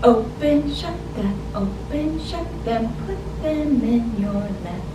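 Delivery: usual speaking voice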